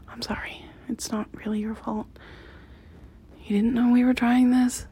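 normal talking voice

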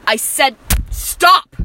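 slow, loud furious yell; angry shout. Acted. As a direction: snap it out in rage. Captor snaps at captive.

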